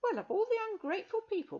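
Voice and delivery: put-on high, outraged young girl; be shocked at her ingratitude; girl indignant at the ungrateful witch; indignant Rosemary.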